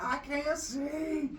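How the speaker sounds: high, slow wail; blinded panic. Acted. Boy blinded by the light, high and slow. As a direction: cry out half-blind.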